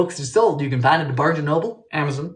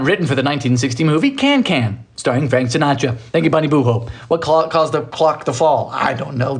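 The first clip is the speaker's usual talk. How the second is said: normal talking voice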